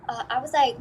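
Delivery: normal talking voice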